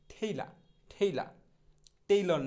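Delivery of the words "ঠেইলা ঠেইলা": ঠেইলা with aspirated ঠ and open "laa", no r; corrected pronunciation. Shown as a demonstration. Teacher modelling tailor for learners, ঠেইলা with aspirated start. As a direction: start ঠেইলা on a puffed "th", drop the r. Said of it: "সঠিক উচ্চারণটা কী হবে".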